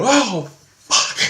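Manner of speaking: high, loud frightened curse; startled at the head slipping behind the tree, loud and high; scared gasp. Retold or imitated. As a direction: blurt it out in shock. Sees the figure vanish.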